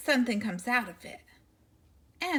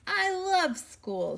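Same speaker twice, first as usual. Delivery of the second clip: dad voice; 9 semitones higher, much slower than usual. Acted.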